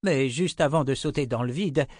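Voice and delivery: ordinary delivery, nothing marked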